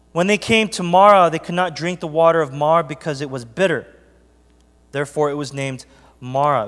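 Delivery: loud, ringing Bible reading to the congregation; scripture reading. Acted. Pastor reading Exodus to the church, proclaiming. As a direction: proclaim the verses to the pews.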